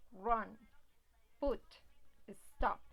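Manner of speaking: run, put, stop each as one punchy syllable; one beat per word. Shown as a demonstration. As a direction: isolate each word as a single loud burst of voice. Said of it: "tener un solo golpe de voz".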